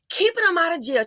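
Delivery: usual speaking voice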